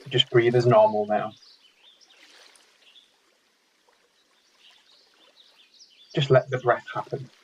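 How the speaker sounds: calm meditation guide with long pauses between phrases; unhurried, measured instructions, leaving silence after each; guided meditation. Real, not acted. Meditation leader guiding an online mindfulness group, calm and spaciously paced.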